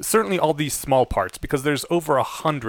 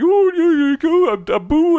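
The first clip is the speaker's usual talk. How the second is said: much higher, louder mocking put-on accent; mock Indian accent; 7 dB louder than usual. Host retelling the accent that hurt a listener, mocking Indian accent. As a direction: parody the taunting schoolyard accent. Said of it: "the same accent that people in high school would always torment me with".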